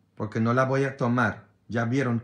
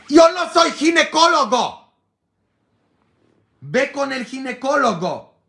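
much higher, louder yell; shouting at them; 12 semitones higher, 8 dB louder than usual. Pretend. Priest yelling at churchgoers to see a gynecologist. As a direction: yell it the way he wishes he could. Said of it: "así gritarles".

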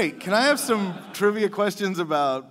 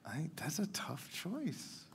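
whispering; 16 dB quieter, slower than usual. Real, not acted. Contestant agonizing over choice.

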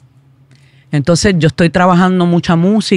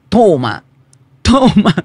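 lightly imitated catchphrase, second one higher; 3 semitones higher, slower than usual. Singer quoting her collaborator's signature word, lightly imitating. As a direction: call it twice, lifting the repeat. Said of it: "que siempre dice".

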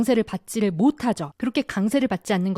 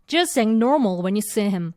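slow, "just" and "normal" pressed; stress on just and normal; much slower than usual. Shown as a demonstration. Teacher drilling the phrase, slow stress on "normal". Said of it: "요렇게 연결하시면 되죠".

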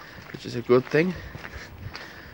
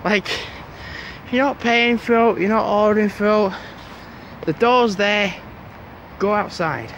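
exasperated; 5 semitones higher, 3 dB louder than usual. Real, not acted. Ranting about people not ordering.

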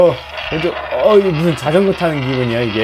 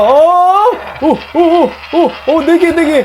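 high thrilled counting of coins; excited counting; 13 semitones higher, slower than usual. Real, not acted. Gamer grabbing four coins, high excited counting. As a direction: count them off, buzzing with joy.

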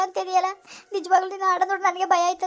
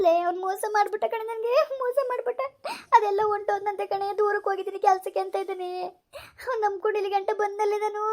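crying. Acted. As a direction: sob it out, betrayed. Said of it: "ಯಾಕೆ ಅಳ್ತಾ ಇದೀಯಾ". (ಅಳುತ್ತಾ ಮಾತನಾಡುವುದು)

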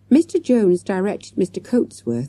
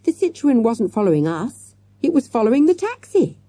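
high, building to a loud peak; eager, rising urgency; excited cry; 7 semitones higher, 3 dB louder than usual. A narrator's reading. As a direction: blurt out the realisation. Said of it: "cried Morgan".

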